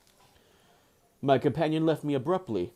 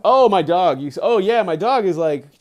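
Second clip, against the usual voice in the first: loud, higher burst of alarm; sudden loud yelp of surprise; startled outburst; 4 semitones higher, 10 dB louder than usual. Real, not acted. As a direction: blurt it out at the dog. Startled by humping dog.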